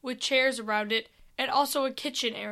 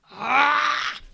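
a louder, raging creature cry; raging growl; 3 semitones higher, 5 dB louder than usual. Acted. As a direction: let the creature's rage burst out. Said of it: "it started to rage".

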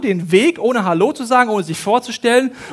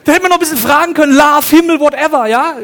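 fast, higher and louder; excited; 8 semitones higher, 8 dB louder than usual. Real, not acted. Telling the congregation what they could have asked, excited. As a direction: rush it out, thrilled. Excited afterthought about the angel.

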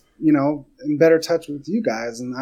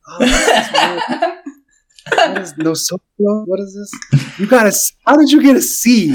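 mock bafflement; 7 semitones higher, 4 dB louder than usual. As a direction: play up the puzzled cheater.

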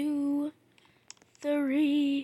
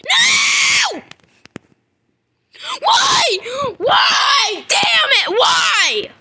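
high, loud anguished shouts; furious yelling; 11 semitones higher, 8 dB louder, much slower than usual. Real, not acted. Player raging at the game after dying, shouting. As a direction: scream at the screen.

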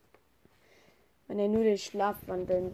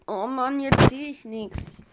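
ordinary delivery

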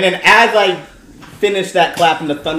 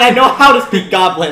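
ordinary talk, nothing marked

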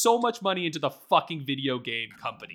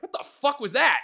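high incredulous question; baffled; 9 semitones higher, 3 dB louder than usual. Retold or imitated. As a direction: sound baffled and higher. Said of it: "And I was like".

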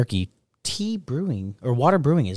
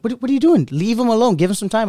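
mock yelling; 9 semitones higher, 4 dB louder than usual. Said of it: "servers were yelling at me".

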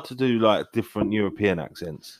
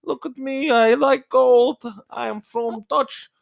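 put-on very high, slow comic Dutch accent; hammed-up Dutch caricature; mock Dutch; 5 dB louder, slower than usual. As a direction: squeak it out slowly in fake Dutch. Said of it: "give me an example of Dutch".

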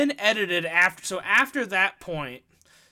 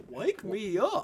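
high, soft cartoon singing imitation; 4 semitones higher, 7 dB quieter than usual. Co-host trying Bring Me to Life, high cartoon voice. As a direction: lilt the lyric like a dopey cartoon. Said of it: "as Goofy".

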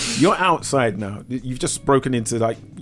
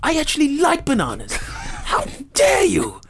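high, indignant cries of outrage; the gorilla protesting bigotry; offended gorilla; 12 semitones higher than usual. Pretend. To the man correcting him, hammed-up indignation. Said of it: "as the gorilla".

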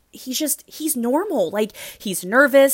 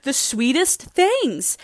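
high, tearful-sounding gush; overwhelmed by sweetness, pitched up; emotional gushing; 11 semitones higher than usual. Retold or imitated. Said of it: "I'm like".

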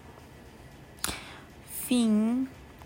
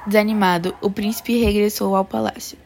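ordinary delivery

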